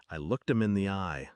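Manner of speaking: plain reading voice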